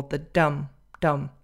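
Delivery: "dum" low and reduced to a schwa; unstressed syllable. Shown as a demonstration. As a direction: drop "dum" in pitch, as the weak end of kingdom. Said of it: "It's unstressed".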